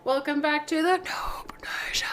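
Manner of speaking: whisper yelling. Real, not acted. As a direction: whisper it but push it out. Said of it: "I'm whisper yelling".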